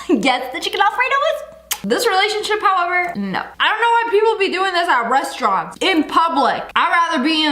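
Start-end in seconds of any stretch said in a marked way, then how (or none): 0.1-1.4 high, put-on polite voice; pitched-up pretend niceness; switched-up customer voice. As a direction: switch to fake pleasantness for the waiter. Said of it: "switch it up for the waiter real quick".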